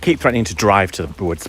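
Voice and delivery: normal talking voice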